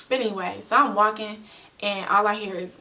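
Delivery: ordinary talk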